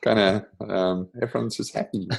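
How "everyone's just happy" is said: laughing. Real, not acted. Laughs at own joke.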